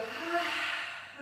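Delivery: big open sigh out through the mouth; audible exhale sigh. Shown as a demonstration. Teacher showing the class the big exhale, audible sigh. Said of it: "exhaling through the mouth".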